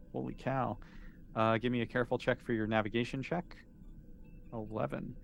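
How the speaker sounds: ordinary talk, nothing marked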